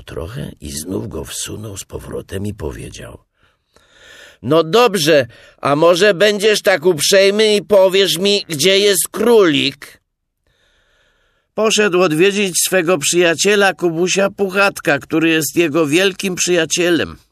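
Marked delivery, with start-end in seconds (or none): 4.4-10.0 loud, high polite request; high, hollered enquiry; calling out. A narrator's reading. Pooh calls into the burrow. 11.5-17.2 loud, high explanation; high, sustained holler; shouted reply. A narrator's reading. Hidden Rabbit shouts back.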